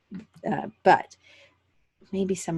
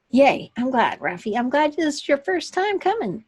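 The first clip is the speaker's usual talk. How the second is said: bright, higher, louder greeting; happy, lifted hello; excited welcome; 8 semitones higher, 5 dB louder than usual. Real, not acted. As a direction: gush a real welcome to a newcomer. Welcoming a first-time attendee.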